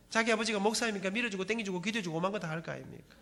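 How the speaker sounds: normal talking voice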